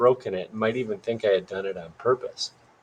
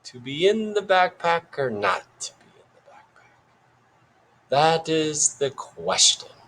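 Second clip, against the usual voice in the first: put-on British accent; 7 semitones higher than usual. A narrator's reading. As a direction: declaim it like a British Shakespearean actor. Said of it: "in a sort of British accent".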